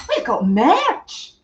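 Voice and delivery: attempted Scottish catchphrase voice; Scottish accent. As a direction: draw it out in mock Scottish. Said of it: "the only Scottish accent I will attempt".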